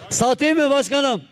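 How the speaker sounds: going once, calling out to the boss; auctioneer. Real, not acted. Auction call, raised again.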